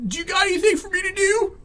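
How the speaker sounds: high, fast mocking whine; mocking whiny coworker. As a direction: squeak it out like a pest begging for work. Mocking pushy photographer's question.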